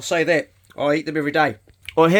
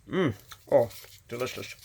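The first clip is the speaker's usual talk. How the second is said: savoring taste; 4 semitones lower, 6 dB quieter than usual. Real, not acted. Tasting berry.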